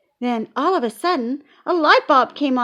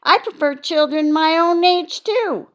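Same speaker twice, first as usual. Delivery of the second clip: put-on higher, louder eager girl; little girl voice; 8 semitones higher, 6 dB louder than usual. A narrator's reading. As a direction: copy your friend, keen. Lucille voiced in story.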